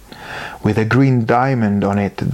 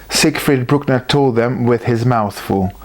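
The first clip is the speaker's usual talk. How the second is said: ordinary delivery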